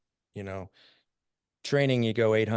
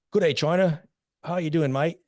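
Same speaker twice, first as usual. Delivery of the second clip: cheery Australian greeting, higher and louder; Australian accent; 7 semitones higher, 4 dB louder than usual. Retold or imitated. Aussie soldier greets the marching Rangers, in a cheery Australian accent. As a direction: chirp it like a cocky Aussie soldier. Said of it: "They're like".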